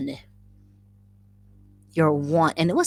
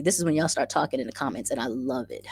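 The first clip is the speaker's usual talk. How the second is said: normal talking voice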